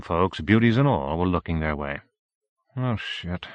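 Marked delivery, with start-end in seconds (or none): 2.8-3.5 quiet dismayed curse; softly. Acted. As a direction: breathe it out under your breath. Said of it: "said Howie softly".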